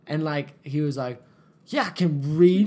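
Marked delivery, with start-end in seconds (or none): 1.7-2.7 slow, loud, higher mock retort; drawn-out offended reply; imitating indignant comeback. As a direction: act out an affronted man's defensive answer. Acted man's indignant comeback.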